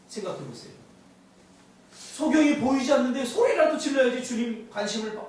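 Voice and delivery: ordinary talk, nothing marked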